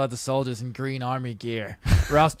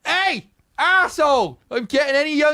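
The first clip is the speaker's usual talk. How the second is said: shouting from afar; 13 semitones higher, 7 dB louder than usual. Acted. Officer shouting at the sergeant from afar, loud. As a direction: bellow across the hall.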